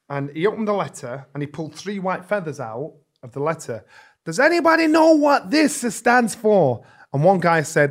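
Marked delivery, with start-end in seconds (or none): 4.2-6.8 announcer voice. Retold or imitated. Trainer addressing crowd from ring.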